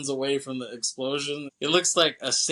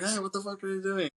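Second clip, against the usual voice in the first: retold cry of disbelief, pitched way up; mock indignant; 8 semitones higher, faster than usual. Gang sees the plane leaving, mock indignant cry.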